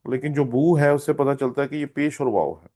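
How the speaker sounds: normal talking voice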